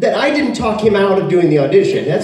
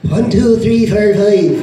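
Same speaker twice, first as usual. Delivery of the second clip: put-on Irish accent; 3 semitones higher, slower than usual. Imitating a dialect tape, mock Irish accent. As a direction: count in a badly faked Irish brogue. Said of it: "now with the Irish accent".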